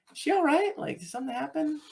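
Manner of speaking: high-pitched imitation of worried questioning; concerned onlooker. Voices crew questioning the parents.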